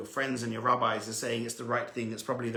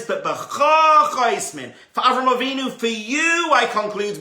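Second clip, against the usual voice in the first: slow, higher and much louder proclamation; voicing God; 9 semitones higher, 11 dB louder, slower than usual. Preacher voicing Hashem's promise, slow and booming. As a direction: declare it slowly like a voice from above. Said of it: "And Hashem says".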